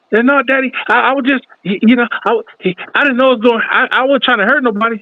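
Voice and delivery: mock-whiny kid stammering excuses, pitched high; scared pleading. The boy pleads with his angry dad, hammed-up high whine.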